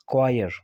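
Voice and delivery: "क्वायर" starting with a k sound; correct pronunciation. Shown as a demonstration. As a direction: say "क्वायर" as kwa-yer. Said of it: "इसकी जो pronunciation है वो क्या है". ("क्वायर", क से सही उच्चारण)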